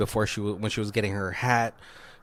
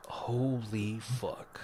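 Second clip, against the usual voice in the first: slow, breathless whisper of horror; shocked whisper; 9 dB quieter, much slower than usual. Retold or imitated. Nova spotting the doll in the back seat, whispering in shock. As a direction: hush it out, stunned by what you see.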